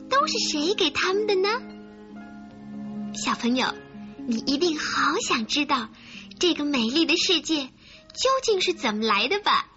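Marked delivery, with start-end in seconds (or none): none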